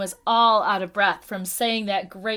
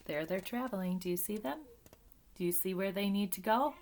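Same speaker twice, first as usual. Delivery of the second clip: quiet aside; 2 semitones lower, 11 dB quieter, faster than usual. Real, not acted. Aside to children.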